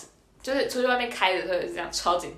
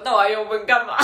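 边笑边问; 3 semitones higher, 6 dB louder than usual. Retold or imitated. 天真地问教练.

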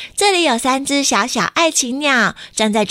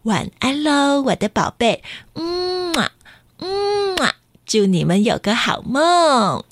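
tender goodnight; 4 semitones higher, slower than usual. A narrator's reading. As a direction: lull them to sleep. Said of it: "妈妈正在跟哥哥弟弟说".

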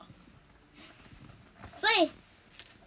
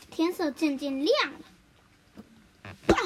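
usual speaking voice

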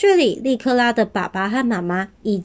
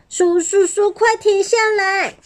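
little dinosaur pleading; 10 semitones higher than usual. A narrator's reading.

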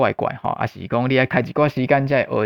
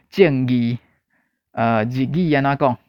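normal talking voice

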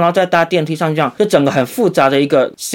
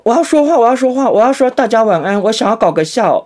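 desperate; 9 semitones higher than usual. Retold or imitated. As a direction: push the words out before going under. Fighting the anesthesia.

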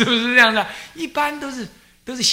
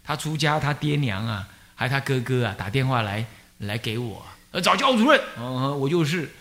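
ordinary talk

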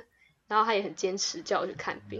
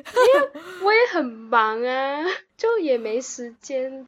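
whiny excuse; 4 semitones higher, 8 dB louder, slower than usual. Real, not acted.

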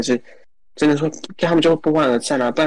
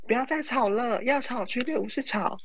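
high-pitched, softer mock scold; high soft mock plea; 12 semitones higher, 7 dB quieter than usual. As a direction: squeak it gently. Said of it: "对，对".

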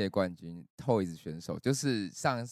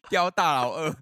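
laughing through words, louder; 6 semitones higher, 5 dB louder than usual. Real, not acted. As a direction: giggle out the joke. Joking about rehab ward.

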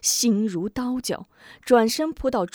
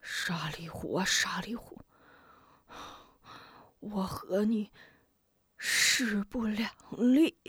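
through gritted teeth; 6 dB quieter than usual. Acted. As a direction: squeeze it out through clenched teeth. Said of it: "从牙缝里挤出一句话". (咬牙切齿地低声说)

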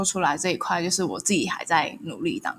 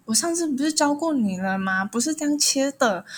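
raised, scolding mock complaint; lightly hammed-up reproach, pitched higher; complaining boyfriend; 7 semitones higher than usual. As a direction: imitate him nagging her about the cutting. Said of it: "然后就一直有点complain".

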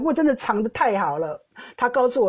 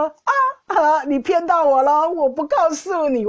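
teasing gotcha; 6 semitones higher than usual. Retold or imitated. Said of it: "他就说".

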